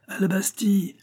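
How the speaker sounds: high-pitched call to arms; rallying cry. A narrator's reading. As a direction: cry out high, without much extra volume. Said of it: "cria Pitou". (cri de ralliement aigu)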